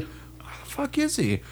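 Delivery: soft, high-pitched baffled mutter; imitated muttering to himself. Sergeant searching for a missing soldier, quiet baffled self-talk. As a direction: ask it under your breath, puzzled. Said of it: "I literally hear him talking to himself".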